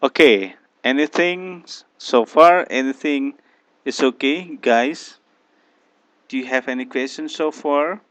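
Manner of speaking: loud call across the room to the students; calling out to class. Real, not acted. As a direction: raise your voice so the whole class hears. Teacher calls out to class.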